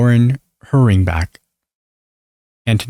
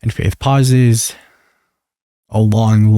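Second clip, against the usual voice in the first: usual speaking voice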